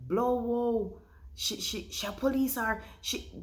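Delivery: a touch of imitated disbelief; amazed onlookers. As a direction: gasp like a fan who can't believe it. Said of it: "your character and say".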